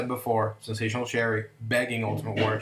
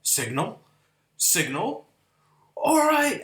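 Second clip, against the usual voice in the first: puzzled then fired up; 8 semitones higher than usual. Retold or imitated. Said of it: "Savage was like".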